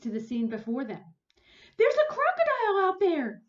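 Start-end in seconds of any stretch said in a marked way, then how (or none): 1.8-3.4 high, loud alarmed cry; alarmed gasp. Acted. Onlooker crying out in alarm at the crocodile. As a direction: warn the beach in alarm. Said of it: "someone gasped".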